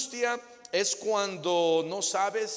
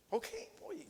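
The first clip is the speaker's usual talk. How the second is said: soft eager okay; 13 semitones higher, 11 dB quieter than usual. Acted. Husband eagerly agrees.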